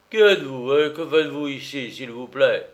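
loud, stern officer questioning a suspect; loud gendarme demanding answers; grave gendarme voice. A narrator's reading. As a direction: interrogate with official authority. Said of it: "d'une voix grave".

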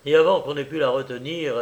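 usual speaking voice

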